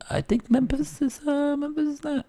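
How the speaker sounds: high nasal put-on voice, quiet; Aldo voice. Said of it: "see if I do my Aldo voice".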